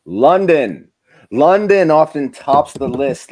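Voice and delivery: big, loud host announcement. Acted. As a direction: call out the city like a countdown. Announcing top city on list.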